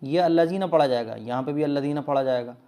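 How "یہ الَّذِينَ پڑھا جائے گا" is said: normal talking voice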